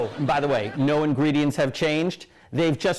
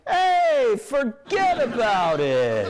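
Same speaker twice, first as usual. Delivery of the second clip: New Jersey firefighter; 8 semitones higher, slower than usual. Acted. Jersey firefighters brushing off a grateful deer, hammed-up shout. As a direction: holler it slow and drawn out like a Jersey tough. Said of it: "firefighters were like".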